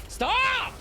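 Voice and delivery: sharp, high, loud "Stop!"; shouting stop. Real, not acted. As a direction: yell it to make them quit. Yelling at dogs biting her.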